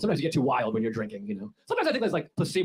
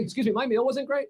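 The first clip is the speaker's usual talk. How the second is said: higher, prissy complaint; mock whiny customer; 9 semitones higher than usual. A customer complaining to a McDonald's employee, whiny. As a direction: whine it like a picky customer.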